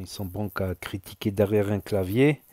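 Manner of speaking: normal talking voice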